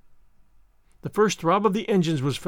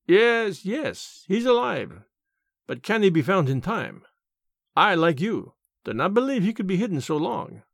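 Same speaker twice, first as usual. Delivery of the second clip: put-on French-accented detective, insistent yet questioning; Poirot, pensive insistence. Poirot agrees with the detectives, pensively insistent, French-accented. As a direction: lift the first words, accented and sure.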